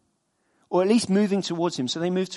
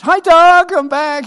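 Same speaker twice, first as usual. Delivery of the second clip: high, loud sing-song greeting; dutiful cheery hello to the neighbour; calling hello; 10 semitones higher, 10 dB louder, slower than usual. Retold or imitated. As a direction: call it over the fence.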